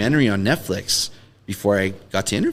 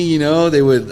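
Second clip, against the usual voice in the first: usual speaking voice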